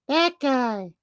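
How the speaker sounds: very high put-on child voice; exaggerated high kid voice; mock little boy. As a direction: squeak it like an accusing eight-year-old. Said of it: "He pointed at the person".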